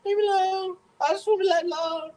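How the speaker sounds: high whiny plea to be left alone; mock whimper like a sulking sick man. Sick man begging his family to leave him be, high and whiny. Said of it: "I'm just like".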